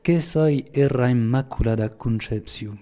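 slow, measured Lourdes patois. Shown as a demonstration. Preacher quoting the Virgin's enigmatic phrase, slow Lourdes patois. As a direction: recite it slowly in Pyrenean patois. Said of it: "je vais le dire en patois".

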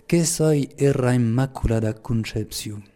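slow, measured Lourdes patois. Shown as a demonstration. Priest quoting Mary's enigmatic phrase to listeners, in patois. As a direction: pronounce it slowly in the local Occitan patois. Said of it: "je vais le dire en patois".

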